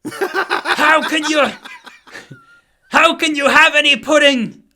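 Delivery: high, loud shouted rant; shouting schoolmaster. Retold or imitated. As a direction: bellow it like a mad teacher. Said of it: "I don't even think that's the same voice".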